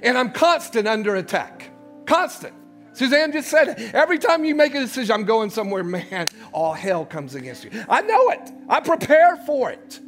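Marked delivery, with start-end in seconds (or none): none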